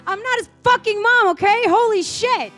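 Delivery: screamed, very high and very loud; furious sung scream. Acted. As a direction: scream it from the top of the lungs. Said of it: "I scream from the top of my lungs".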